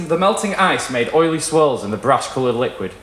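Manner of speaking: ordinary delivery, nothing marked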